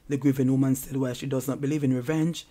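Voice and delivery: usual speaking voice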